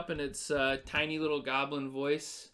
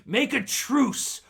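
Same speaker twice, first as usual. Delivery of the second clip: slow, high, loud goblin threat; 5 semitones higher, 6 dB louder, slower than usual. Acted. Goblin holding Sildar hostage demanding a truce, loud slow threat. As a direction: snarl the ultimatum across the cave. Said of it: "and it yells out to you".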